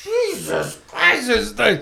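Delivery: high, drawn-out mock astonishment; retold dismay. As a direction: stretch it out high, as if appalled. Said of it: "And I'm like".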